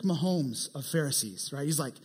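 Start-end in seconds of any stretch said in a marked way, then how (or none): none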